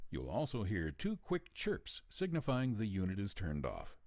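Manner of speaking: usual speaking voice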